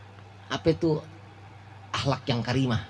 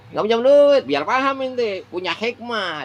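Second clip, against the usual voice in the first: joking; 8 semitones higher, 4 dB louder than usual. Acted. Punchline, much higher and louder.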